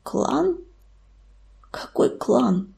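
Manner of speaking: soft, slow, dazed question; quiet confusion with pauses; lost, bewildered. A narrator's reading. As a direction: ask faintly, as if lost. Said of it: "потерянно спросил Крутобок".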